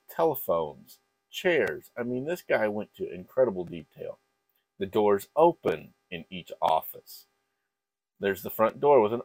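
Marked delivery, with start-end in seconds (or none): none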